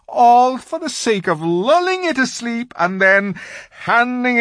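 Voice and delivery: loud, high, wailing faun; anguished confession. A narrator's reading. Faun confessing to Lucy he lures children, anguished. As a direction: pour out guilt in a wail.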